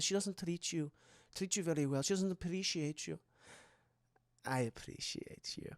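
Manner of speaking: very soft, low Esther voice; imitated creepy seduction. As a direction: sink to a near whisper, coaxing.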